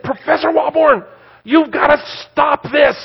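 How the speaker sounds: high urgent plea, slowed on the second line; a young woman begging him to stop it; retold panicked student. Student begging the professor to stop, high and urgent.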